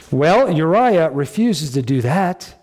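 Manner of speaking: ordinary delivery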